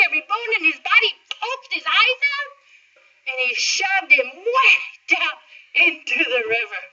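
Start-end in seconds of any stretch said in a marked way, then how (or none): none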